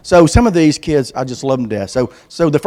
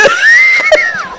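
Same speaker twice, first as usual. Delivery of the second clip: whooping laugh; 4 dB louder than usual. Acted. Mock joy, search over.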